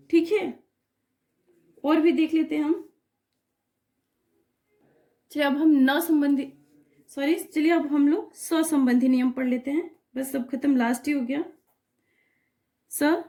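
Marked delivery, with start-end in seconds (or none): none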